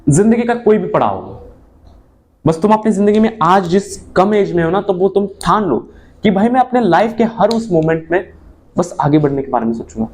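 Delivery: ordinary talk, nothing marked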